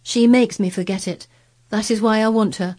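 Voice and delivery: put-on petulant young master's declaration; fretful boy Colin. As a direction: insist on getting your way.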